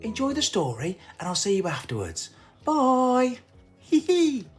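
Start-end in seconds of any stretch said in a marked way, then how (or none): none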